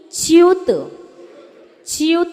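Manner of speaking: च्युत, chyut, high and crisp; च्युत taught clearly, with a rising tune; modeled syllable. Shown as a demonstration. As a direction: snap च्युत out as one syllable. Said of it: "च्युत नहीं".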